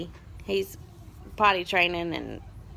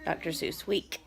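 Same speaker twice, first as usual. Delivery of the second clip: ordinary delivery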